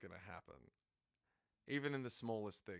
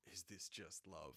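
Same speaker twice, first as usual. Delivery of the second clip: ordinary talk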